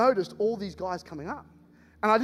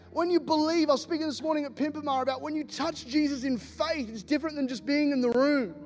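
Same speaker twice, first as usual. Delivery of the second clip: ordinary talk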